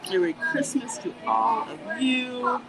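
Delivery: normal talking voice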